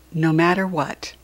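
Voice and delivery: plain reading voice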